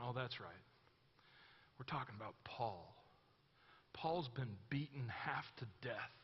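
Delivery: hushed, low and soft; sobered realization. Retold or imitated. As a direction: speak softly, as if humbled by the memory. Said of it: "catching the shift in the tone".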